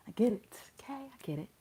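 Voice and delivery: whispered under breath. Real, not acted. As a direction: drop to a resigned whisper. Resigned aside after "I understand.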